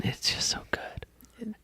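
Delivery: breathy, soft whisper. Real, not acted. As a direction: whisper it with delight. Gushing about the show.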